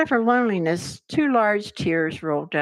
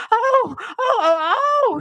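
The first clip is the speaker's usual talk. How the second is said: much higher, louder shouting oh, oh; shouted oh; 5 dB louder than usual. A narrator's reading. Queen crying out over her bleeding finger, high shout. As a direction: cry out sharply, high and loud. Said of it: "shouted the Queen".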